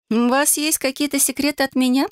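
high, teasingly sceptical question; хмыкая. A narrator's reading. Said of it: "хмыкнула его молодая супруга". (высоким голосом, с недоверчивой усмешкой)

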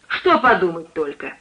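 cook sharply querying "подумать только"; challenging re-ask. A narrator's reading. Suspicious cook asks the lodger to explain, challenging him. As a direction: throw the words back, "Что" hit hard. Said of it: "переспросила она".

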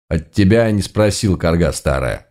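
plain reading voice

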